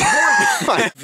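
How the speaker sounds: very high laughing yelp; helpless squeal of laughter; shrieking laugh. Real, not acted. Loud laugh breaking take.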